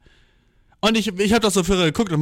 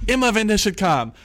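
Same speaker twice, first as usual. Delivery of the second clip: ordinary talk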